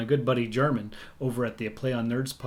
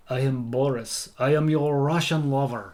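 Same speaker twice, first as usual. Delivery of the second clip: put-on slow Russian lover accent; hammed-up Russian seducer; Boris; slower than usual. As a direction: woo slowly in a thick Russian accent. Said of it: "Oh, Boris the Russian lover".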